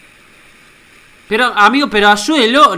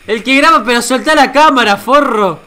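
yelling at the screen; 6 semitones higher, 10 dB louder than usual. Real, not acted. Yelling at cameraman in video.

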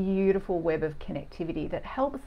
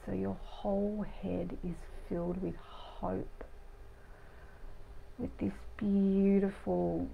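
lingering, soothing meditation delivery; guided meditation; 5 dB quieter than usual. Real, not acted. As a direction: guide listeners gently into their bodies, unhurried.

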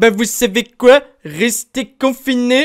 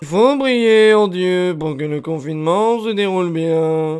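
Reverend Lovejoy voice; 5 semitones lower than usual. Acted. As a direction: lower solemn preacher voice. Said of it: "Révérend Lovejoy". (voix du révérend Lovejoy)

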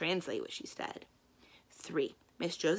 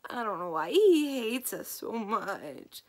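high, slow tearful wail; 4 semitones higher, 6 dB louder, slower than usual. Acted. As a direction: wail it like a heartbroken little girl. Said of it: "Hyacinth said with a wail".

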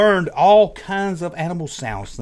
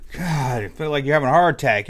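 ordinary talk, nothing marked

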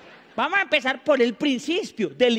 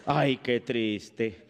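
very slow, low mock lament; mock sorrow; 11 semitones lower, 4 dB quieter, much slower than usual. Comedian pities the discarded napkin, mock sorrowful. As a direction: sigh it out low and drawn-out. Models mock pity for audience.

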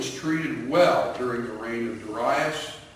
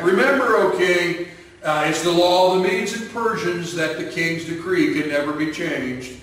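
insistent courtiers; 2 semitones higher, 5 dB louder than usual. Acted. Conspirators to the king, loud insistent warning. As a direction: push the king into a corner.

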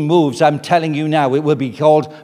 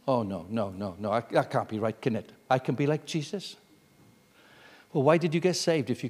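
sudden hush; 4 semitones lower, 9 dB quieter than usual. Real, not acted.

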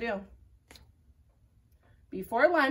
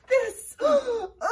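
higher, louder, playful fright; mock scream; 13 semitones higher, 7 dB louder than usual. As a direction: shriek like you saw a monster. Final germ reveal scream.

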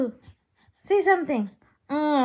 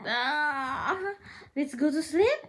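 ordinary talk, nothing marked

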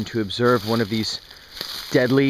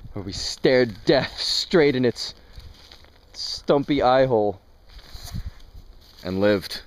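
dramatic sign-off; slower than usual. Pretend. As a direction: proclaim it like an epic documentary ending.

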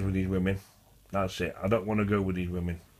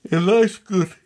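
excited; 10 semitones higher, 9 dB louder, slower than usual. Real, not acted. Loud cheerful declaration.

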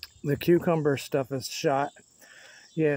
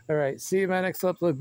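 ordinary talk, nothing marked

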